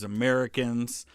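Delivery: ordinary delivery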